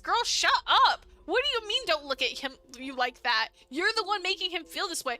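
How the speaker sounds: furious streamer yelling at a game character; real outrage. Real, not acted. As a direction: scold her like she can hear you. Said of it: "I'm getting so mad".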